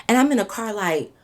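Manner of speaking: ordinary delivery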